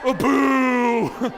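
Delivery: loud playful boo; mock boo. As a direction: boo them in jest. Booing Android users.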